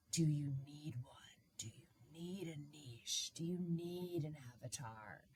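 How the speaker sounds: hushed mock-guru whisper. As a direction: whisper the buzzwords. Mimics recurring business question.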